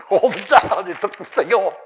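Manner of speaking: tickled by the tale; laughing. Real, not acted. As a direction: laugh as you tell it. Laughs retelling the flag stunt.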